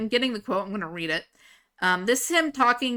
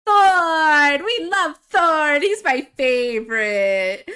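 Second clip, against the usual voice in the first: high, loud fangirl cheer; squealing with delight over Thorn; excited gushing; 6 semitones higher, 7 dB louder, much slower than usual. Real, not acted. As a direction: cheer for your favorite character. Gushing over her favorite character.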